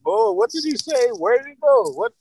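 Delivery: high, baffled voice playing dumb; mock confused politician; mock flustered dodge. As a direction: play the innocent politician ducking the question.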